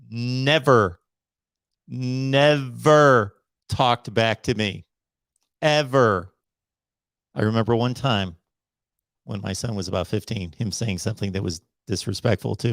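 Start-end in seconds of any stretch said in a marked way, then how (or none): 0.1-6.3 slow, weighty stress on each "never" and "ever"; drawn-out, insistent "never... never... ever"; emphatic. Real, not acted. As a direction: stretch "never" and "ever", a pause between. Hammers home that kids never did.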